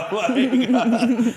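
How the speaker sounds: laughing hard, high and loud; bursting out laughing; loud laughter. Real, not acted. Laughing at own story punchline.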